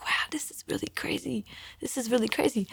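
whispered wonder, trailing into soft voice; hushed amazement. Retold or imitated. New believer marvelling at the gospel, hushed amazement. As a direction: whisper it as if stunned.

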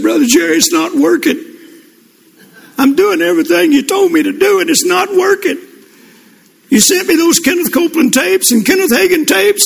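high, pleading retold crying voice; crying man. A man calls his pastor crying over the phone, high and pleading. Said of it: "and he was crying".

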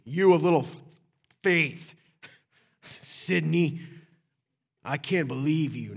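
loud mock scolding, punched word by word; angry. Shown as a demonstration. Preacher shows how Jesus didn't say it, angry scolding. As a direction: bark it as an exasperated telling-off. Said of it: "he didn't say it angry".

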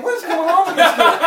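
very high, loud mock bewilderment; hammed-up baffled yell; incredulous outburst. Preacher in the kitchen puzzled by the kid, yelling incredulously. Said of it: "I'm saying".

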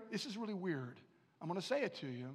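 ordinary talk, nothing marked

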